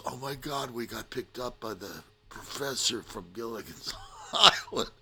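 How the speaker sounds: high-pitched mock confiding, breaking into laughter; imitated laughing whisper. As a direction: retell a friend's giggly aside, cracking up at the end. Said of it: "whispers in my ear, laughing".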